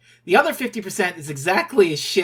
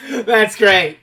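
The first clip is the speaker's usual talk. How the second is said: loud, through laughter; laughing; 4 semitones higher, 10 dB louder than usual. Real, not acted. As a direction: say it while cracking up. Amused by the co-reader's answer.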